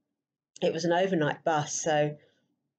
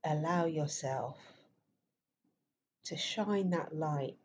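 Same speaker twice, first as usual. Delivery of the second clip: guided meditation; 6 dB quieter than usual. Acted. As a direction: softly guide listeners into stillness, leaving space between phrases.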